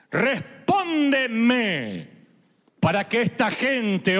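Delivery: slow, far-carrying proclamation to God; grand Hollywood-style prophet's prayer, loud and very slow; solemn dramatic prayer. Acted. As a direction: pray out loud like Elijah before a crowd, drawing out each phrase. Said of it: "Elías da un paso adelante y ora así".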